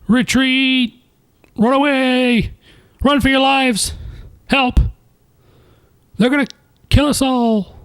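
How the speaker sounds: high panicked yells of retreat; panicked yelling. Acted. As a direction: shriek like a soldier fleeing in terror. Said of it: "I just really have to yell".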